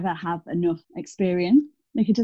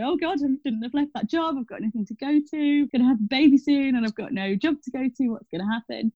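higher-pitched, mildly panicked inner voice; retold worry; 6 semitones higher than usual. Expectant mother recalling she had no job to go to, retold worry. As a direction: act out fretting, pitch raised. Retelling fear of having no job.

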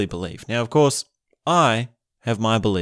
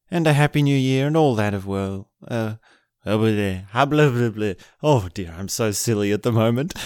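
normal talking voice

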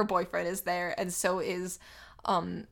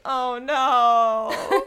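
mock dismay; 3 semitones higher, 7 dB louder than usual. Acting Frankie's dismay.

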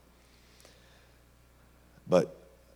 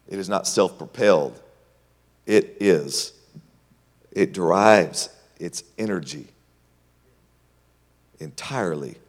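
full preaching; 2 semitones higher, 6 dB louder than usual. Acted. Preacher exhorting the congregation to trust God. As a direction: drive the point home to the church.

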